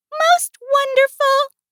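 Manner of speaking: high, slow, awed little voice; soft mouse. A narrator's reading. Mouse looks at the glowing moon, soft high voice. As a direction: marvel gently as a tiny mouse. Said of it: "Mouse said softly".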